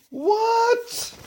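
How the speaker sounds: a very high, stretched-out "What?"; shocked squeak. Pretend. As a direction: jump way up in disbelief. Reader shocked by the text.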